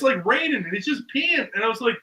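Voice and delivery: ordinary talk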